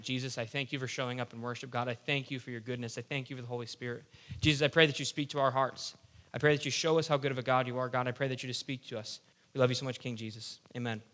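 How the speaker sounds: soft, low, earnest prayer. Real, not acted. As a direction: pray quietly and sincerely over the group. Leads the group in prayer.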